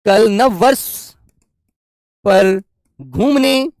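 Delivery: slow, word by word with long pauses; teacher dictating an example; slow dictation. Shown as a demonstration. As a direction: dictate the sentence for copying. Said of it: "दूसरा वाक्य मैं लिखता हूं आपके सामने".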